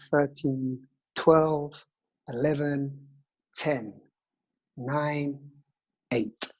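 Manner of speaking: calm counting breath guide; slow soothing breathwork instructor; guided meditation. Acted.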